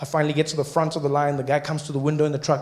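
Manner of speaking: ordinary talk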